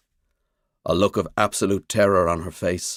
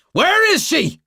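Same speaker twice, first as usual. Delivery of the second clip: loud, high furious roar; 6 dB louder, slower than usual. A narrator's reading. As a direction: bellow it at him. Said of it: "I roar".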